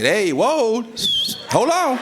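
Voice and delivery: high-pitched mock indignation; alarmed protest. Husband interrupting the officer questioning his wife, alarmed protest. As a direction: jump in to stop the questioning.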